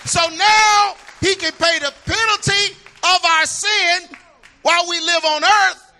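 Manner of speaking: high, loud, rising gospel preaching; climbing shouted sermon cadence; preaching at full force. Acted. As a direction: whoop it up from the pulpit, slowing on the big words. Preaching to the congregation.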